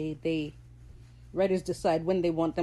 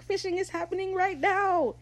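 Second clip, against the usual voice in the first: excited Ed; 12 semitones higher than usual. Retold or imitated. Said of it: "He's like".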